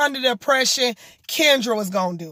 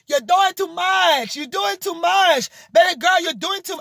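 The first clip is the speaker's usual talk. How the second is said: higher-pitched attempt at the singer's accent; [name]'s accent; 6 semitones higher than usual. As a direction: imitate her accent, pitched up. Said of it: "I'm not gonna do her accent".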